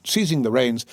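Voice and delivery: plain reading voice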